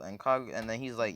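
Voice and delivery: ordinary talk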